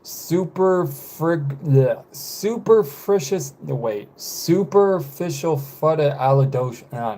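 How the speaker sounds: drawn-out, botched tries at "Super frig-", giving up midway; stumbling over pronunciation. Shown as a demonstration. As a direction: slowly attempt "superficial fudda", breaking off and restarting. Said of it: "but I couldn't say".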